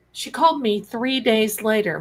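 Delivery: ordinary talk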